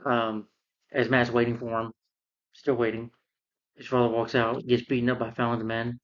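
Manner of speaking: usual speaking voice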